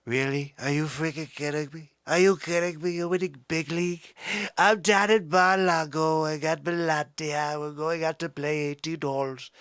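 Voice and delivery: comic presidential impression, punchy and boastful; Trump. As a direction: put on a blustering politician voice. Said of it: "When you do a Trumper".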